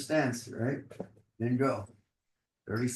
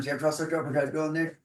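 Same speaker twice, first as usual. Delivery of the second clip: ordinary talk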